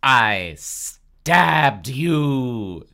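very slow, loud dramatic wail; hammed-up, drawn-out cry. A narrator's reading. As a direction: stretch every word in horrified disbelief. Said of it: "Dina cried".

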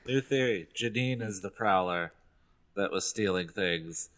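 normal talking voice

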